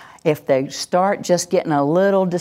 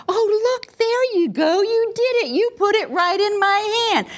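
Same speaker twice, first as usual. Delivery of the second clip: much higher, gushing praise; enthusiastic praise. Shown as a demonstration. Parent praising the child for handing over the remote. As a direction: scoop her up with enthusiasm. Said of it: "scoop her with my enthusiasm".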